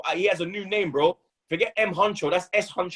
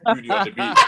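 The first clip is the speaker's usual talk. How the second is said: mock beat tag, pitched up and pushed out; producer tag; 12 semitones higher than usual. Fan on podcast mimics a producer's beat tag, pitched up. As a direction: call it out like a rapper's producer tag.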